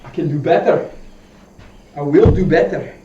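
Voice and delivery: mock Scottish burr, badly faked; hammy attempt at sounding Scottish; bad Scottish accent. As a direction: put on a horrible Scottish accent. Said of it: "It's a horrible Scottish accent".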